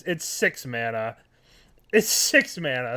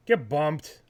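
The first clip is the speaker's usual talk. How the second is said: ordinary talk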